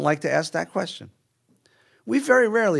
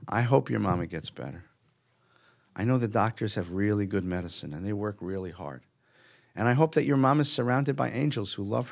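put-on softer, lower voice soothing a child; gentle comfort; 5 semitones lower, 5 dB quieter than usual. Rabbi reassuring a child whose mother is sick, gentle and hushed. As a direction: comfort a scared kid kindly.

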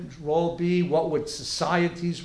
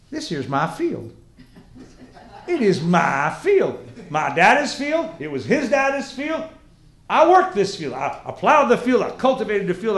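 indignant farmer; 3 semitones higher, 7 dB louder than usual. Acted. Farmer defending his field to students, indignant. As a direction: play a proud farmer staking his claim. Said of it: "Farmer says to you".

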